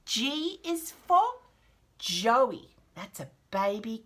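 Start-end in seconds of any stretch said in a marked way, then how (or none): none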